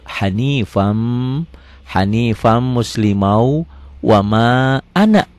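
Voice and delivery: slow, drawn-out Arabic recitation for learners. Shown as a demonstration. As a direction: recite slowly, stretching the long vowels. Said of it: "perlahan ya".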